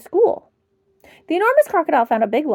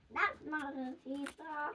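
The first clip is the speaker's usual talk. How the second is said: slower trailing whisper; slow echo whisper; 21 dB quieter, slower than usual. Acted. Monkey's warning echoing in a whisper. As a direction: let it echo away slowly.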